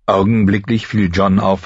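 ordinary delivery, nothing marked

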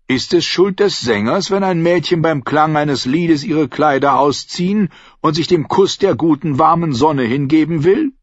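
high, lilting self-defence; singer protesting innocence. A narrator's reading. Singer excusing himself to the innkeeper, theatrical protest. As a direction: plead your case with a flourish.

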